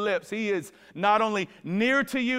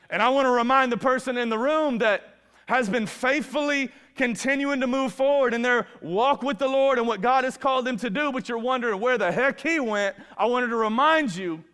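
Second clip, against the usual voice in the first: higher and louder, surging sermon appeal; preaching at full force; 5 semitones higher, 4 dB louder than usual. Acted. Preacher reaching out to the faithful in the room, full force. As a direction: lift it and push it to the back row.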